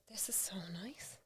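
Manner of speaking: soft whispered relief; whispering quiet awe at a calm home. Retold or imitated. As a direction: breathe it out in a whisper. Recalling the peaceful house.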